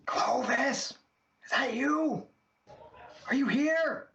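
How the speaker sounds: high, hushed stage-whisper calling. Acted. Spangle calling for Clovis in a stage whisper. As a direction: call out in a fake whisper. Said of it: "a stage whisper".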